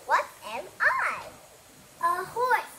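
usual speaking voice

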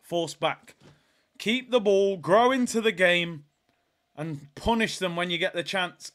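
ordinary talk, nothing marked

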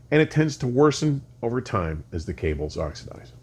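normal talking voice

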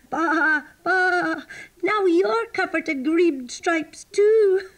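high, loud, giggly bleating; laughing sheep. A narrator's reading. Sheep laugh at the freshly striped sheepdog, high and loud. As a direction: bleat with mocking glee. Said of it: "The sheep started to laugh".

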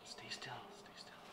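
very soft coaxing whisper. Real, not acted. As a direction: keep your voice down near the snake. Whispering to a snake in tank.

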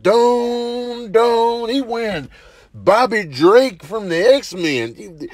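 normal talking voice

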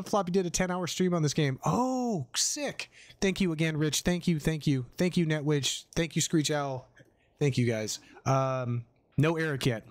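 ordinary delivery

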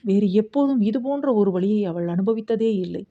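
plain reading voice

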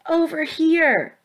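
high, drawn-out holler; calling shout. A narrator's reading. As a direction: call across a distance to draw them over. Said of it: "Valian shouted".

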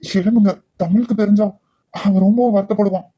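hushed begging to the married woman; hushed pleading. Acted. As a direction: keep it down so nobody hears. Man pleads secretly, hushed.

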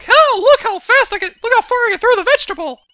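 very high, loud squeal; hammed-up boasting in a piercingly high Toad voice; Toad impression. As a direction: show off at the top of your squeaky range. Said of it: "watch Toad like".

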